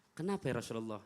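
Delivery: quiet, low answer; soft reply. Retold or imitated. Aisyah answering the Prophet, soft and meek. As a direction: answer meekly and softly. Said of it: "Rasul panggil".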